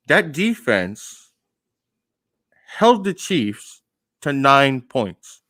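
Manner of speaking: slow emphatic. Real, not acted. Emphasising the defense.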